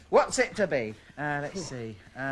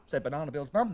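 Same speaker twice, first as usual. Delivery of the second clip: ordinary talk, nothing marked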